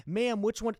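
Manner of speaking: panicked retold shout to the clerk; urgent call out. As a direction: call out as the camera snaps, alarmed. Photo taken mid-question.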